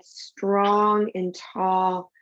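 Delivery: drawn out very slowly. Shown as a demonstration. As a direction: stretch every word, holding the pose. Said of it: "side to side".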